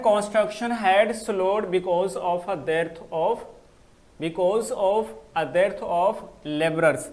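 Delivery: a teacher dictating the next sentence, slow; slow dictation. Shown as a demonstration. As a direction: spell each English word out for the class. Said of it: "because of की meaning".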